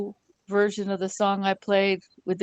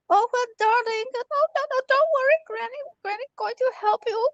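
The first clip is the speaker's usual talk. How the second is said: put-on much higher, doting old grandma reassuring; granny soothing the fake grandson on the phone; sweet granny voice. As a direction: coo like a gullible granny. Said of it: "So I'm playing along".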